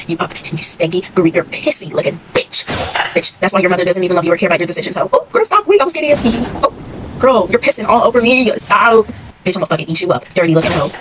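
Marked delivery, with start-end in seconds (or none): 3.1-5.1 a fast, loud roast rattled off; rapid-fire roast. Real, not acted. Gamer roasting an opponent in one fast breath. 5.1-9.1 high, loud squeals of protest; shrieked protest. Real, not acted. Player shrieking at an enemy who is hitting her. Being hit, protesting loudly.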